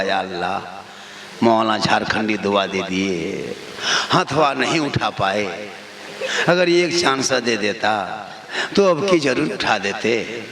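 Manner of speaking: very low, subdued imagined prayer; inner regretful thought. Acted. Preacher voicing a man who didn't raise his hand, low private lament. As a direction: mimic someone privately lamenting a missed chance. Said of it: "वो मन में सोचता होगा".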